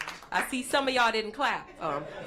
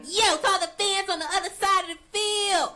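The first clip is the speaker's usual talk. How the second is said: higher, mock-menacing chant, repeating the challenge; threatening cheerleaders; 8 semitones higher than usual. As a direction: taunt the other side's fans like rough cheerleaders. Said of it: "They're like".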